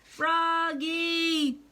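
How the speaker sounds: high, loud call. A narrator's reading. Mother calling her son from the garden, loud. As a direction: call him across the house. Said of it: "called his mother".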